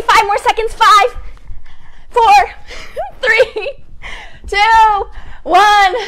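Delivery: loud, high, hyped countdown; excited. Real, not acted. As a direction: pump up the whole room.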